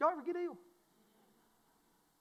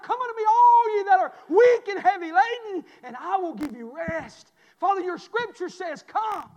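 louder, higher prayer swelling to a shout; fervent prayer; 8 semitones higher, 12 dB louder than usual. Real, not acted. Pastor pleading with God for his church, rising to a shout. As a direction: let the prayer swell into a cry.

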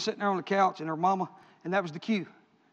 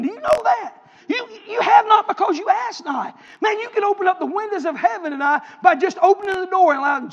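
urgent preaching; 8 semitones higher, 4 dB louder than usual. Real, not acted. Preacher urging listeners to open the door, urgent preaching. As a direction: pitch lifts high in exhortation.

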